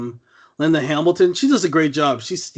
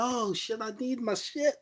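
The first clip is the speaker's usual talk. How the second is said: much softer, high, laughing junkie moan; mock junkie mumble; 7 semitones higher, 11 dB quieter than usual. Mocking the heroine who shoots up every minute, soft and laughing. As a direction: moan it softly like a strung-out addict. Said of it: "She's like".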